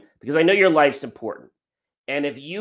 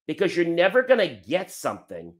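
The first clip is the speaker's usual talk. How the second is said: usual speaking voice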